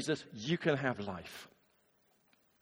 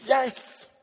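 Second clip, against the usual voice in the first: eager yes; 10 semitones higher, 5 dB louder than usual. Shown as a demonstration. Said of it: "expecting the answer".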